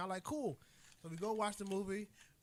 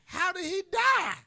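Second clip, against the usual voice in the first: put-on shocked child; 9 semitones higher, 9 dB louder, slower than usual. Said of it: "He's dead?".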